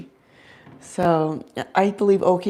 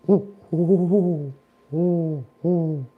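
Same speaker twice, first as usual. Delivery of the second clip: owl hoots; 2 semitones lower, slower than usual. Shown as a demonstration. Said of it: "how I communicate with him".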